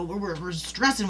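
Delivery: usual speaking voice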